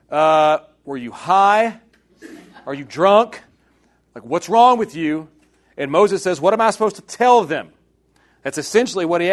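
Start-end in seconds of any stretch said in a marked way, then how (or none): 0.1-5.3 louder, disbelieving questioning of someone's sanity; mock incredulous. Israelites doubting Moses, acted out. 6.4-7.7 higher, louder pleading question; mock exasperated. Moses' protest to God, acted out.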